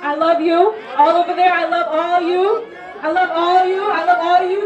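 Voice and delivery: loud, drawn-out declaration to a crowd; calling out. Real, not acted. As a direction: shout your love to the far side. Calling out love across crowd.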